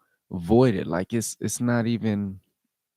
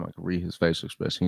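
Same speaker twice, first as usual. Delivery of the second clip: ordinary talk, nothing marked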